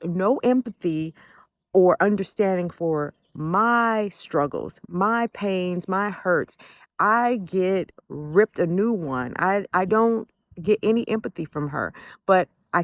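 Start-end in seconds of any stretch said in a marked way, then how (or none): none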